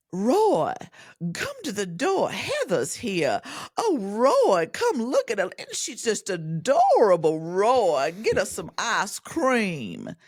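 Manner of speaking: high, loud, drawn-out Southern drawl; gushing Southern grandma, high swooping vowels; step-grandmother's Southern accent. Retold or imitated. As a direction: call out slowly in thick Southern twang. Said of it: "and even Southern".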